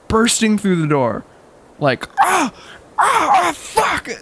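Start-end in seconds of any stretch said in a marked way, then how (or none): 2.2-4.1 high, hammed-up pained yelps; dramatic cries. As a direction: overact the pain. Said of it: "All dramatic".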